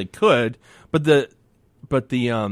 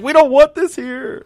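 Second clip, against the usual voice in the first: drawn-out indignant whine, pitched way up; 14 semitones higher, 7 dB louder, slower than usual. Retold or imitated. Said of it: "these rednecks are going crazy".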